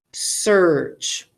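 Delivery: "Search" said slowly, the er drawn out; teacher modelling search. Shown as a demonstration. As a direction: stretch "Search" long and even. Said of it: "Here's a word".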